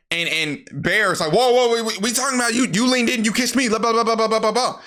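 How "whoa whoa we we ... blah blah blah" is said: high-pitched panicked protest; freaking out. Retold or imitated. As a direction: babble a mock-flustered defense, high throughout. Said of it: "He's freaking out and shit".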